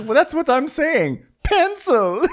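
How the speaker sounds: very high put-on voice; mock Diana. Cohost mocking Diana's pencil line, very high. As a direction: squeak it out smugly. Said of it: "she goes".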